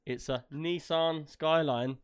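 slow, drawn-out Nissan as NEE-sahn; imitated American pronunciation. Brit quoting Fast and Furious to his co-host, mock American pronunciation. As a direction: mimic a film line with Nissan as "NEE-sahn". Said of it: "you ever heard him say".

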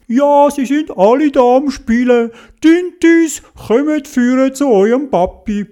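high, lilting teacher's call; teacher calling children. A narrator's reading. Teacher summoning the little squid to their daddy, calling out high.

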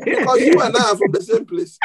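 real laughter spilling out after the joke; laughing. Real, not acted. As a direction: tease them, then crack up laughing. Joking with congregation about shared accent.

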